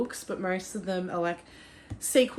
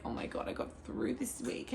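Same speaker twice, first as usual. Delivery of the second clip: relieved whisper; 3 semitones higher, 11 dB quieter than usual. Retold or imitated. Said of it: "and I'm like".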